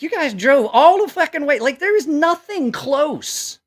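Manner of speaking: high, loud disbelieving yell; incredulous shout. Retold or imitated. Recalling the shock at the customers, a high disbelieving shout. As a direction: shout it like you can't believe they drove out here.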